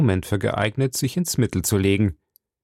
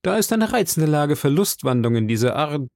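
higher, heated challenge; calling out; 5 semitones higher than usual. Acted. D'Artagnan calls out to the Englishman, higher heated challenge. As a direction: fling the words at him.

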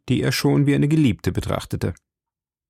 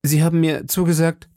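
higher-pitched, embarrassed protest; 6 semitones higher than usual. A narrator's reading. D'Artagnan reminding Madame of her promise, embarrassed. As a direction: protest awkwardly, voice raised in pitch. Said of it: "erwiderte D'Artagnan verlegen".

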